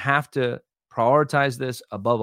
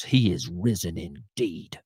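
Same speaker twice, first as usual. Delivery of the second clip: low, slow, softened solemn rebuke; grave, drawn-out mock sternness; stern disdain; 7 semitones lower, 5 dB quieter, slower than usual. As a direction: imitate a disdainful churchgoer correcting someone. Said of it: "shakes it sternly, looks at me with great disdain".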